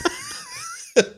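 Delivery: a squealing burst of laughter; high shrieking laugh. Real, not acted. Friend asked a gross food question, shrieking laugh. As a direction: lose it completely at the question.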